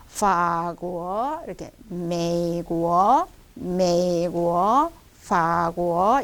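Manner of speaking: 法国 and 美国 said low and slow, tones held; 美国 drawn out in a low third tone; tone demo. Shown as a demonstration. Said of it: "이 삼성하고 이성 하는 발음".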